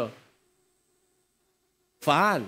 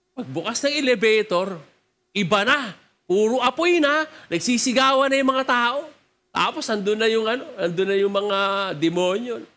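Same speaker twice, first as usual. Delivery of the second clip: normal talking voice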